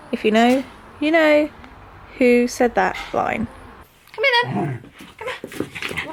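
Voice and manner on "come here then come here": high call fading to breath; high dog-calling voice. Real, not acted. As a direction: call high, then soften.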